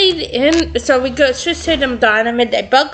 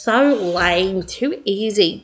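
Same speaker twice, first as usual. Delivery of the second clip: bored scoff; 5 semitones lower, 4 dB quieter, slower than usual. Acted. Code cracked, bored sister.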